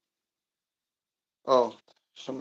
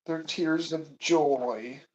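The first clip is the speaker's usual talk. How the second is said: slow proud pronouncement; 5 dB louder, much slower than usual. Pretend. Mock grand chef statement.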